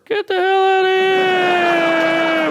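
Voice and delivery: high, loud, slow disbelieving shout; mock outrage. Comic shouts at a parent bragging that the four-year-old is sober. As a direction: yell it like you can't believe the brag.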